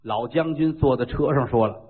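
usual speaking voice

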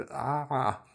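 mock scared cries. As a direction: let out little suppressed screams. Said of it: "发出一个不太雅观的叫声".

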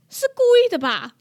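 a sudden alarmed question to herself; thinking the bird meant it; startled suspicion. Retold or imitated. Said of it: "我一开始想说".

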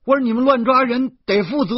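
loud, high, indignant shout; outraged hollering; angry protest. A narrator's reading. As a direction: yell the complaint at the officers. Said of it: "我恼怒地嚷嚷".

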